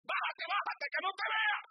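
high, loud panicked urging; frantic, make him get down now; panicked cry. Acted. Eve shouting at Adam to hide from God.

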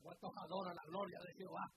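ordinary delivery

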